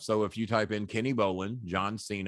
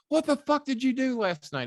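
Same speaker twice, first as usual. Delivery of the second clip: high, loud, exasperated demand; imitating a furious colleague's phone call; angry Cornette; 4 dB louder than usual. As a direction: bark it like a furious boss on the phone. Cornette calls him, angry.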